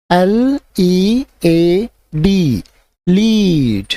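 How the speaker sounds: letters spelled out slowly, then the word; slow spelling drill. Shown as a demonstration. Teacher spelling words aloud slowly for class.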